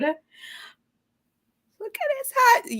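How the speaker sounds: high, very slow admiring coo; drawn-out coo. Real, not acted. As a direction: stretch it out in awe.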